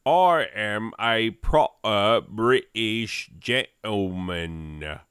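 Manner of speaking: put-on slow, stiff posh British accent; hammed-up posh English gentleman; proper British gentleman. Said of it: "all of the accents".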